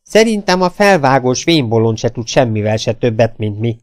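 usual speaking voice

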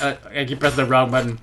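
normal talking voice

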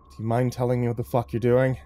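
ordinary delivery

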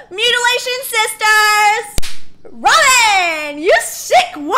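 high, loud gleeful yelling; delighted shout. Real, not acted. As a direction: scream it with glee.

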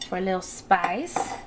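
usual speaking voice

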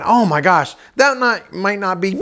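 mock dawning panic; alarmed realisation. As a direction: stumble as you see trouble coming. Sudden realisation as rope runs out.

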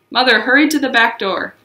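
ordinary delivery, nothing marked

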